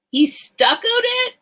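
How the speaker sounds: very high, loud disbelief; astonished question. Real, not acted. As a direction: shoot up high in amazement. Amazed reaction to dollhouse detail.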